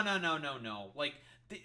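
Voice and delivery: ordinary delivery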